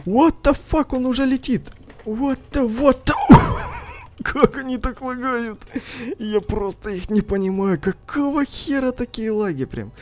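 high, stunned what the; shocked disbelief. Real, not acted. Shocked at laggy enemy.

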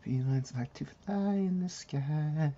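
high, slow goofy voice; playful high singsong; silly sing-song. Pretend. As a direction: croon it like a goofy rhyme.

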